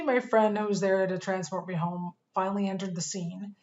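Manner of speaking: usual speaking voice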